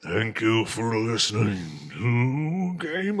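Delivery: slow, drawn-out zombie voice. Acted. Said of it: "it's hard to talk like a zombie".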